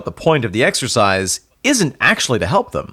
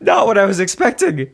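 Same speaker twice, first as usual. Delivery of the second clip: high joking voice; 9 semitones higher than usual. Acted. Still in silly voice.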